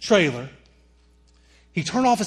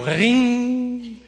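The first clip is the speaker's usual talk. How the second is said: phone ring; 6 semitones higher than usual. Shown as a demonstration. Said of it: "And everybody go".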